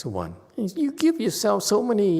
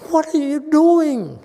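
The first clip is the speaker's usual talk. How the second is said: loud, much higher demanding question; exasperated shout; 10 semitones higher, 7 dB louder than usual. Real, not acted. Master yelling at students who drift off, loud.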